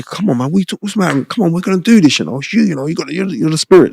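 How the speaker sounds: friend's pep talk. Retold or imitated. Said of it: "he comes over and he".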